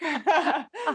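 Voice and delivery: short high giggle; a quick amused laugh; light laugh. Real, not acted. Laughs at own joke.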